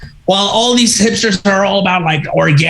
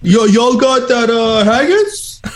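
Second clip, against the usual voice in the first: higher, slow put-on tourist voice; mock tourist; 6 semitones higher, slower than usual. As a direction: ask for haggis cluelessly. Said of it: "and be like".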